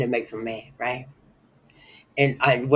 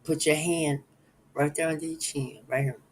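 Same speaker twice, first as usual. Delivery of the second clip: ordinary talk, nothing marked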